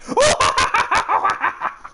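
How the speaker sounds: high breathy creepy clown laugh; Pennywise laugh. Shown as a demonstration. Showing her laugh is very Pennywise, creepy clown giggle. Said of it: "my laugh is all like".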